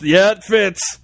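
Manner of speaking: quick verdict from a general, lightly put on; lightly voiced military commander; mock general. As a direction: play a general giving approval. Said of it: "the general goes".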